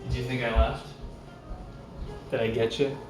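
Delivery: ordinary talk, nothing marked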